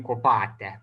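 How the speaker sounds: "kopate" with a long accented -pa-; circumflex ending accent. Shown as a demonstration. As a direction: stress a drawn-out PA in kopate. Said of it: "circumflex accent on the ending".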